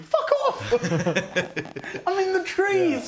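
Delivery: high laughing shriek; laughing outcry. Real, not acted. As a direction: cry out through laughter. Player laughing at gust of wind.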